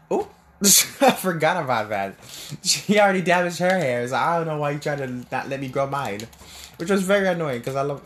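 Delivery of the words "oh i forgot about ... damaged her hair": laughing recollection. Real, not acted. Laughs remembering aunt's hair.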